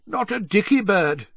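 breezy high-pitched reply; easygoing jovial dismissal; comfortable, unconcerned. A narrator's reading. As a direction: answer cheerily without a care. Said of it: "said Bagman comfortably".

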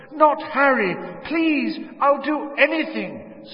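scream-plead for her son; desperate pleading. A narrator's reading. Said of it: "his mother's voice was louder than ever".

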